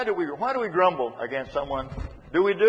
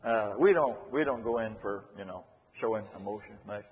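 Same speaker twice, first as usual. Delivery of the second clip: lightly voiced reticent man, low and trailing off; a hint of an awkward, undemonstrative man; reluctant man; 6 semitones lower, 4 dB quieter than usual. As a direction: mumble hesitantly, softer and lower. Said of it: "Some man will say".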